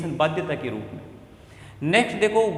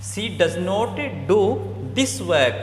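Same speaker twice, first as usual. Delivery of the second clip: slow dictation; much slower than usual. Shown as a demonstration. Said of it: "meaningful sentence बनाता हूँ".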